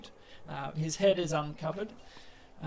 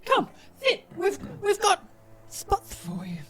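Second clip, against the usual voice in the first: high, teary goblin voice, louder; excited goblin host; 4 dB louder than usual. Acted. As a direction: welcome them in a much higher, weepy goblin voice. Goblin host welcomes the party.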